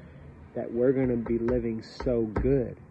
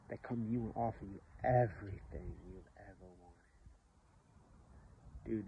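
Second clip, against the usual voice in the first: usual speaking voice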